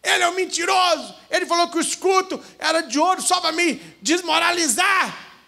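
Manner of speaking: high, shouted complaint; angry pained yell. Acted. Other warrior shouting to the peasant about his leg and the lie. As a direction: yell about the insult through the pain. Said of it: "O outro falou assim".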